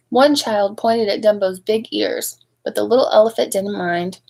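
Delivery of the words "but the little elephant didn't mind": ordinary delivery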